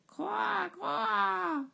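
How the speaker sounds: croassement très aigu. A narrator's reading. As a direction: imite le corbeau, très haut. Corbeau imité par le bûcheron. (croassement aigu de corbeau)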